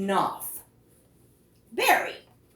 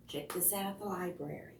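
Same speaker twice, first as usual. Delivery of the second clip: ordinary delivery, nothing marked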